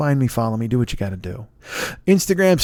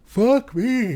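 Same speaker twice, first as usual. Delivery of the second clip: higher, words stretched by a yawn; 9 semitones higher than usual. Real, not acted. Podcast host caught by a yawn mid-plug, yawning. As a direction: talk through a real yawn.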